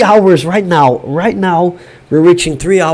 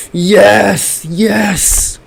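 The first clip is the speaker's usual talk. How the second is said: higher-pitched double yes; exultant cheer; 4 semitones higher than usual. Real, not acted. Fan imagining three Oscars, exultant. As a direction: relish the win on each yes.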